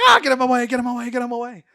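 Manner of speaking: high-pitched comic panic, starting loud; panicked shrieking. Retold or imitated. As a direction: squeal for someone to pull him off you. Hawkeye panics as Hulk lunges.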